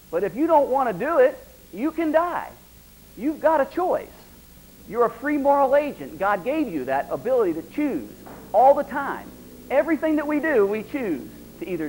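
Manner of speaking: high, loud, insistent preaching push; impassioned preaching. Real, not acted. Preacher urging the men that they always have a choice, high and loud. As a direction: drive it home to the congregation at full force.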